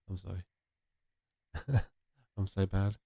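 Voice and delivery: usual speaking voice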